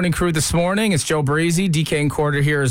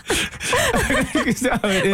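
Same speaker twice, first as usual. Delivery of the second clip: laughing; much slower than usual. Real, not acted. Host laughing mimicking lyric.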